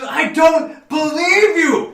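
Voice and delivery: slow, shouted furious disbelief; Titania's furious shout. Acted. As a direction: hurl it back at the husband who lies. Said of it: "She goes".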